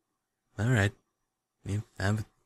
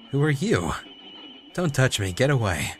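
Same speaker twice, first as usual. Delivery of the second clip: high, louder, panicked pleas; frightened cries; 5 semitones higher, 3 dB louder than usual. Acted. Confused mother cries out in fear at the nurse. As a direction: push away someone grabbing at you.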